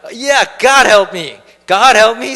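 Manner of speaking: loud, slow, almost shouted; a son lying to his father, crying out to God; loud excuse. Retold or imitated. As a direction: oversell the lie. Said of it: "quickly come up with a lie".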